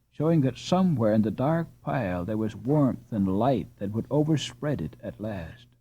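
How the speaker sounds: usual speaking voice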